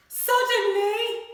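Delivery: high, loud, drawn-out suddenly; big suspenseful reveal; dramatic suddenly. Acted. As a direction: make the kids jump on it. Dramatic story word.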